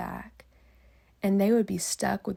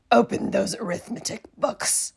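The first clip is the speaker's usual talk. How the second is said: loud, harsh command; stern witch order; 8 dB louder than usual. Acted. Miss Swamp ordering the class to work, loud harsh command. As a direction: bark the order like a tyrant.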